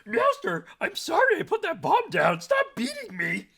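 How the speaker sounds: very high, loud pleading cat; squeaky cat voice. Pretend. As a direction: beg like a squeaky cartoon cat. Playing Palico cat voice.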